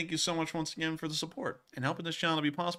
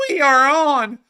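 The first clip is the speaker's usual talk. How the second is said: high, cracking loud voice; 14 semitones higher, 11 dB louder than usual. Real, not acted. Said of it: "that was a voice crack".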